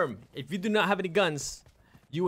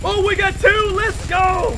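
triumphant shout; 10 semitones higher, 9 dB louder than usual. Real, not acted. Triumphant after a kill.

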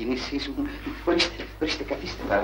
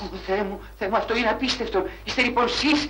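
overwhelmed excitement; 9 semitones higher than usual. Acted. Cashier overwhelmed meeting banker's daughter.

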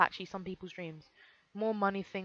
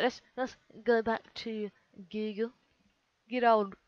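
playful, badly faked Australian accent; mock Aussie accent, lightly done; crappy Australian accent; 2 semitones higher, slower than usual. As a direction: put on a rough Australian twang. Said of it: "My crappy Australian accent".

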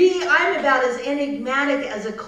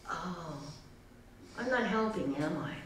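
deflated; 7 semitones lower, 10 dB quieter than usual. Acted. Groundhog realizes, deflated.

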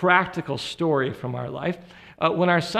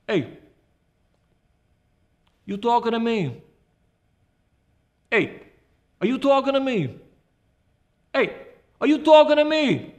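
New York tough guy, pitched higher on each challenge; Robert De Niro impression; 4 semitones higher than usual. Preacher plays a movie star wondering if the Lord is speaking, De Niro impression. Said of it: "my impression of Robert De Niro".

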